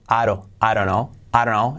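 "I don't know" fused into "aro, arono"; linked words. Shown as a demonstration. Teacher demonstrates joining words, "I don't know" as "arono". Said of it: "Fijaos cómo digo".

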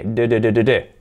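D tapped out repeatedly, voiced and low; isolated D sound. Shown as a demonstration. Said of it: "y la D".

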